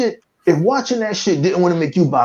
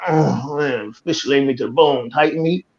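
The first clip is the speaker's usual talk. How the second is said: lower, softer jive voice; jive-talking passenger; 7 semitones lower, 5 dB quieter than usual. Retold or imitated. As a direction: lay it on low and smooth like the jive guy. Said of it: "He told that bitch something".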